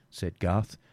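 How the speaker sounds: plain reading voice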